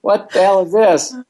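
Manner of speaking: rising, incredulous exclamations; can't believe what he's seeing; exasperated disbelief. Retold or imitated. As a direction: coach in mock disbelief at his team. Exasperated coach inner voice.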